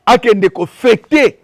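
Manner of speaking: ordinary delivery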